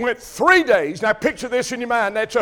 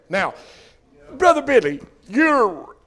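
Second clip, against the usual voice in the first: normal talking voice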